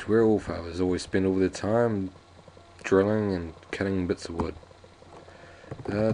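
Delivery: usual speaking voice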